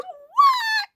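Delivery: very high, drawn-out shocked "What?"; incredulous what. Real, not acted. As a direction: squeal it in disbelief. Mock disbelief at the house.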